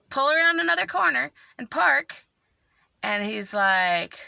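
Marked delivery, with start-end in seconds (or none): none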